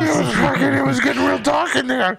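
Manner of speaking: choked-up, high and strained; high emotional voice. Acted. Character emotional, high pitched.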